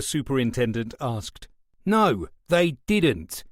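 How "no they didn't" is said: slow, higher and put-out; aggrieved complaint, drawn out. A narrator's reading. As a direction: sound wronged and sulky. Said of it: "Harold answered in an aggrieved tone".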